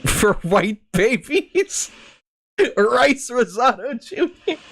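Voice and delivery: laughing. Real, not acted. Reading names through laughter.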